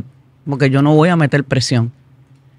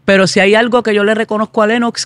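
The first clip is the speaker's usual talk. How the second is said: usual speaking voice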